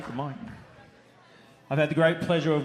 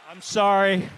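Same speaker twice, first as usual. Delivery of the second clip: ordinary talk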